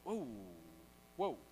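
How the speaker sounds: acted moment of alarm; startled wobble. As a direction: react as if hit by wind. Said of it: "He, like, feels the wind".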